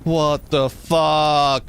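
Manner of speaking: drawn-out, very slow disbelieving what the fuck; slow disbelief. Real, not acted. Streamer staring at his burning tree, slow stunned disbelief. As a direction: stretch it out in shock.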